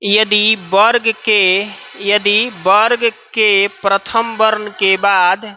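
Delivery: each word spaced out slowly for writing; slow dictation to students. Shown as a demonstration. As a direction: dictate slowly so they can write. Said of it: "लिखेंगे".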